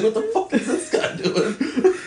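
high, slow, laughing through it; imitating a stunned Bruschi while cracking up; laughing Bruschi quote. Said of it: "Teddy Bruschi's like".

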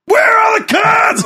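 very high, drawn-out mock yell; losing it. Old man yelling for his son, high acted shout. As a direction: holler across the house, voice straining high. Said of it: "I started losing it".

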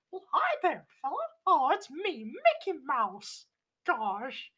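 high cartoon mouse voice, slowing at the name; cheerful high squeak of the famous mouse; Mickey Mouse impression. Showing off his Mickey to a friend, high cartoon voice. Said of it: "I can do Mickey Mouse really well".

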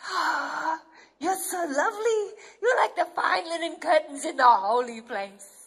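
tearful, laughing praise; adoring bridegroom. Acted. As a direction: reassure the bride she is lovely. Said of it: "he says".

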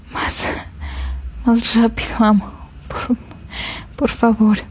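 breathless faltering plea; pleading. A narrator's reading. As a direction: beg softly, voice failing. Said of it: "eso fue una súplica". (súplica entrecortada)

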